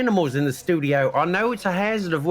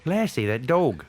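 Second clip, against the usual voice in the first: put-on Australian accent; 3 dB quieter than usual. Put-on Aussie accent throughout.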